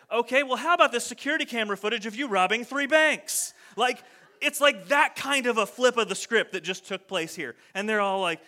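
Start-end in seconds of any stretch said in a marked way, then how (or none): 0.1-3.5 loud, higher, punchy mock retort; mock comeback. Jesus firing back at the Pharisees, loud mock retort. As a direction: fire it back like a gotcha.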